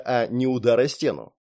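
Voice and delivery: ordinary delivery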